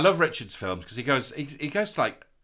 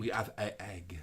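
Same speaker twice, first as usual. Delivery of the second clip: mock waitress; 5 semitones lower, 7 dB quieter than usual. Mocking the restaurant woman's offer.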